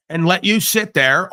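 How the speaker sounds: ordinary talk, nothing marked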